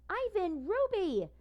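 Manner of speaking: high loud happy calling out; delighted call. A narrator's reading. Snickers greets Ivan and Ruby, delighted call. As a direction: call out to old friends with delight. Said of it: "Snickers calls in a delighted voice".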